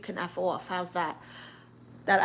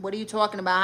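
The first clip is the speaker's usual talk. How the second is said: usual speaking voice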